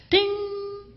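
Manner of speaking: high ringing ding, drawn out; ding of a bell. Pretend. Comedian tallying points for acts of kindness, ringing ding. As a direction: imitate a scoreboard bell, sustained.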